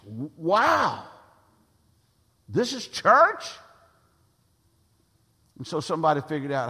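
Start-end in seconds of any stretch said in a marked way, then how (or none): 0.0-3.4 mock stunned youth, rising high and loud on church; shocked disbelief. A kid stepping into church, amazed and appalled. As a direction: play the teen gasping at real church.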